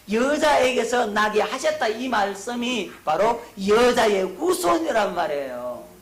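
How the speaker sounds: loud, high preaching at full force; emphatic proclamation. Real, not acted. Preacher explains the woman's offspring, loud and emphatic.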